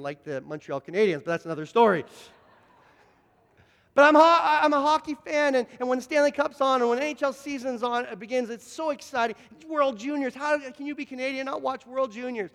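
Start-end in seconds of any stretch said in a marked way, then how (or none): 3.9-12.5 high-pitched, gushing enthusiasm; excited hockey fan. Real, not acted. Hockey fan on the Stanley Cup and World Juniors, excited. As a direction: burst out loud, then keep the pitch up.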